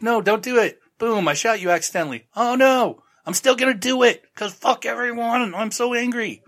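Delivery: high, hammed-up squeaky voice; mock cartoon voice. Frank mocked as a petulant cartoon, high and hammed-up. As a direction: play it as a silly high cartoon. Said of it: "Ed's like".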